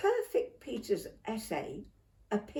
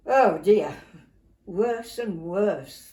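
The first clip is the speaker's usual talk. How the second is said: loud "oh dear", then slow groan; hammed-up horror at a sweet essay; mock dismay; 9 dB louder, slower than usual. A narrator's reading.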